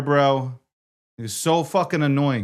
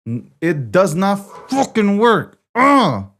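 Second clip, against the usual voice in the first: loud, frustrated swearing at the gear; angry outburst; 7 semitones higher, 6 dB louder, slower than usual. Real, not acted. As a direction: snap at the broken setup. Streamer angry at broken setup.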